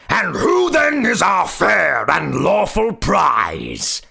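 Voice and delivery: high, slow, ringing goblin shout. A narrator's reading. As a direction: raise the voice high and call it out slowly. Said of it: "raising his voice".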